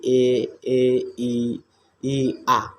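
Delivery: ए ई आ stretched with spread lips; vowel demo. Shown as a demonstration. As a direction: sound out ए ई आ slowly. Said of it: "आवृत्तमुखी स्वर कहलाते हैं". (ए ई आ खींचकर स्पष्ट बोलना)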